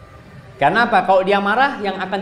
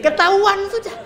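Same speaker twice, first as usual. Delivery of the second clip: laughing. Real, not acted. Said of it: "laughing joke".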